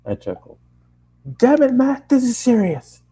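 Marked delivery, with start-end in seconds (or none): 1.4-3.0 hammed-up upset dad calling his son; angry dad voice. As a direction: play the furious father on the phone. Dad scolding on phone.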